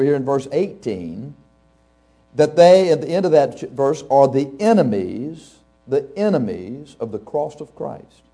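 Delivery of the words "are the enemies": normal talking voice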